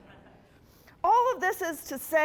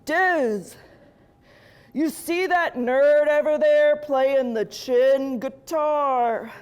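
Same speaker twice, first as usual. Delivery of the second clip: loud swaggering devil bro; cocky devil calling to his bros; 6 dB louder, slower than usual. Retold or imitated. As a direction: brag like a cocky dude devil. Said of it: "and he goes".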